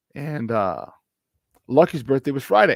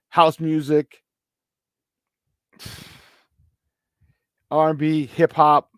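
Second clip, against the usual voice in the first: normal talking voice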